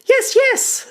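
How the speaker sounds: high, loud goblin squeal; eager goblin. Acted. First goblin agreeing to tell a story, eager squeal. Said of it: "says the first goblin".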